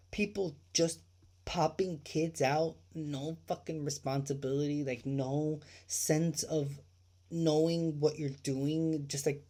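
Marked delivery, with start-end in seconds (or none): none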